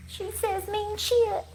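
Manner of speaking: high, slow snide mimic of the hater; mock hater voice. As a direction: put on a high sneering mimic voice. Mocking a hater's voice.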